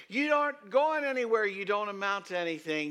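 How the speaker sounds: high, loud scornful put-down; loud, high sneering doubter; mock doubter's shout. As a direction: bark it like a dismissive critic. Preacher voicing the doubters.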